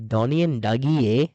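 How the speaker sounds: put-on a loud, clumsy stab at a Scottish accent; a poor, loud mock Scottish accent on the twins' names; bad Scottish accent. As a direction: ham up a Scottish accent badly and loudly. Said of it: "a terrible attempt at a Scottish accent".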